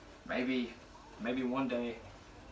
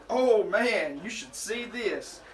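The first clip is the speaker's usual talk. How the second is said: ordinary talk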